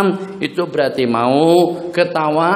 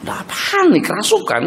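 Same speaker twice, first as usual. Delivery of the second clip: alarmed; 13 semitones higher than usual. Retold or imitated. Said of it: "Kita pun".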